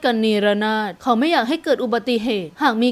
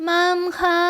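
put-on high-pitched little girl; small child voice; 6 semitones higher, slower than usual. A narrator's reading. Little girl calling to her mother, small voice. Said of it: "เสียงเล็กๆ".

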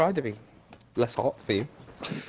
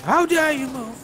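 mock outrage; 9 semitones higher than usual. Mock outrage at dodging enemy.

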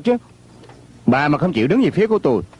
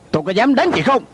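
angry threat; 7 semitones higher than usual. Acted. Acted quarrel, threatens to hit her.

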